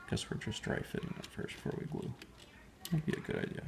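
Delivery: breathy whisper, barely voiced. Real, not acted. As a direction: whisper it under your breath. Whispering while assembling engine.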